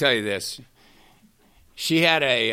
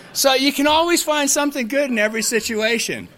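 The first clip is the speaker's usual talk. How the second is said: laughing; 10 semitones higher, faster than usual. Real, not acted. Laughing after the joke.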